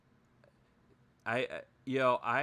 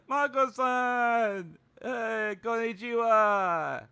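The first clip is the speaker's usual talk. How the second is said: loud, high, drawn-out greeting; hammed-up streamer impression, loud and high; Miko; 9 semitones higher, 6 dB louder, slower than usual. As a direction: call out in an exaggerated high voice, stretching the words. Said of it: "that was my Miko imitation".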